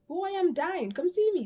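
high, whiny begging voice; whiny plea. Retold or imitated. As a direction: beg like a needy drunk. Whiny plea to boyfriend, retold.

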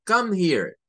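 sounds angry. Shown as a demonstration. Teacher demonstrates a rude command, angry. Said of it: "podría parecer que alguien esté enojado".

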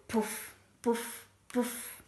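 short separate puffs; imitated plopping. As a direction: mimic droppings landing one by one. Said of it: "io la faccio così". (tonfi soffiati imitati)

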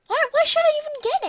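high, loud exasperated question. Real, not acted. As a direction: argue with yourself out loud. Frustration rising.

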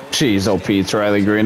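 usual speaking voice

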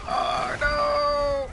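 high, drawn-out wail; dismayed wail. Real, not acted. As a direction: wail it in mock-tragic dismay. Wails as car goes wrong.

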